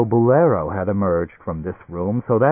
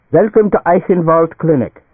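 loud, high German doctor's welcome; beaming welcome; 8 semitones higher, 7 dB louder than usual. A narrator's reading. Doctor welcomes the Nats to his clinic, beaming. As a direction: beam it out proudly in a German accent. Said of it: "he beamed".

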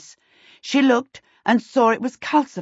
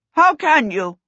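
high, loud challenge; demanding; 8 semitones higher, 5 dB louder than usual. A narrator's reading. Prince challenging the king's threat of trial, demanding. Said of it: "the prince demanded".

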